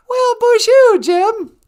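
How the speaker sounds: high, loud sing-song hello to a viewer; excited greeting. Real, not acted. Host greets Jim in the chat with a high, loud cheer. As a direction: call out a big bright welcome.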